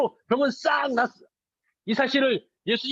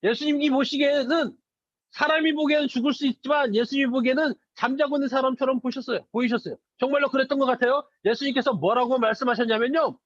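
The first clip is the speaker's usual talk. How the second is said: ordinary talk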